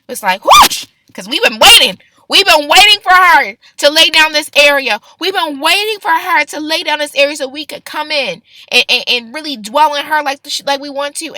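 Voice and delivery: loud, eager heavenly voice; booming joy of waiting spirits; Holy Spirit voice. Retold or imitated. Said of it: "the Holy Spirit just kind of".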